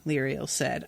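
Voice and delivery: ordinary talk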